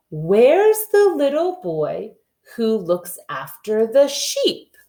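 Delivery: slow, sing-song rhyme reading; nursery rhyme reading. Acted. Teacher reading Little Boy Blue to the class. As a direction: read it out slow and rhythmic for little kids.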